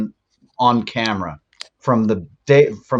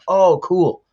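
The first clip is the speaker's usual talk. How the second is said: high, bright oh cool; pleased surprise; 6 semitones higher than usual. Retold or imitated. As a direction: react with sudden delight. Retold pleased reaction.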